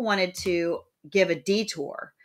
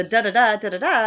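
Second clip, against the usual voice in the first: "da, da, da" twice, higher, each third beat stressed; two anapests; 4 semitones higher, 7 dB louder than usual. Shown as a demonstration. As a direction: beat out weak-weak-strong on "da, da, da". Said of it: "those are two anapests".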